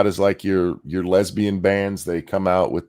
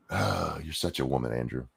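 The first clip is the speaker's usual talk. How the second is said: ordinary talk, nothing marked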